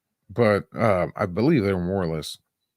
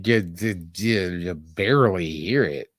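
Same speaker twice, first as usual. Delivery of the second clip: ordinary delivery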